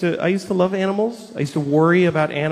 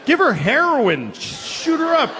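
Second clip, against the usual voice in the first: exasperated demand; 11 semitones higher than usual. Retold or imitated. Ranting about dog to vet.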